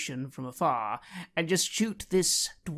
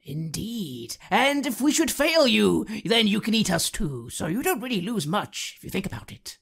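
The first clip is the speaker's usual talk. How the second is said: loud, cocky offer; smug bargaining; 6 semitones higher, 5 dB louder than usual. Acted. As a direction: sell it with a grin. Player character bargains.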